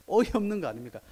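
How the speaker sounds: normal talking voice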